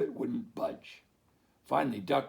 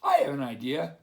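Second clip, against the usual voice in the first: barked idea; 3 semitones higher, 8 dB louder than usual. Acted. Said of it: "Dog barked".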